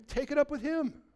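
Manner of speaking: high, pointed comeback; retold retort. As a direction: toss the answer back with a lift. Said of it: "I go".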